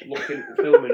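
usual speaking voice

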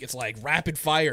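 usual speaking voice

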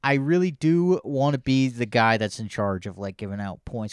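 ordinary delivery

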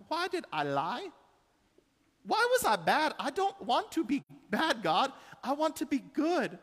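put-on high, pleading little girl voice; high tearful child praying to God; child's broken prayer. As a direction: pray like a remorseful five-year-old. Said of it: "this just broken prayer".

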